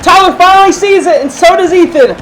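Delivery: loud high excited call; wrestling commentator. Real, not acted. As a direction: shout the reveal. Rivals notice the climber.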